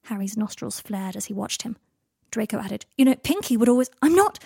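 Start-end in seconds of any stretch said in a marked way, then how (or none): 3.0-3.9 higher, louder needling remark; pressing the argument. A narrator's reading. Draco bringing up Pinky mid-quarrel, pressing the argument. 4.0-4.4 loud, high angry interruption; almost a shout. A narrator's reading. Harry cutting Draco off, almost a shout. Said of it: "Harry came in with a shout, almost a shout".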